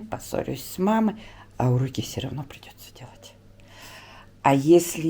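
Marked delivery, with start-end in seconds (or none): 1.6-3.3 whispering. Real, not acted. Coaxing crying son.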